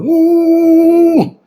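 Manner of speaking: high, sustained "mmm" hum; imitated driving hum. Preacher mimics his drive on an empty road, imitated driving hum. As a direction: hum like a car zooming along.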